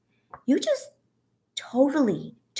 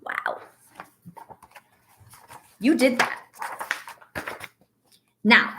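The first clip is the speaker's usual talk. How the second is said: normal talking voice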